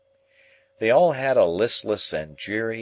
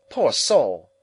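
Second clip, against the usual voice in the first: affected lady; 5 semitones higher, 7 dB louder than usual. A narrator's reading. Camilla sighs over Matthew.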